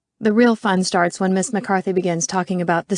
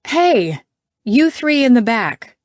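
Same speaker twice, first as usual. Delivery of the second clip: sharp, raised call across a room; bark; 5 semitones higher, 6 dB louder, slower than usual. A narrator's reading. McCarthy calls out three in the back, barking. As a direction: call them out loudly, higher. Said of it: "McCarthy barks".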